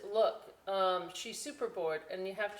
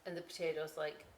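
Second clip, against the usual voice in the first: ordinary delivery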